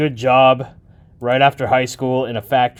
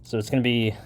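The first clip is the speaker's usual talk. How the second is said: ordinary delivery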